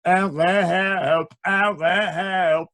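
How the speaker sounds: garbled, slow talk through a mouthful; words mashed by food; mock mouthful mumble; mouth full. Mocking eating with mouth full.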